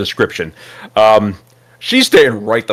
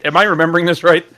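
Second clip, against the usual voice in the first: ordinary talk, nothing marked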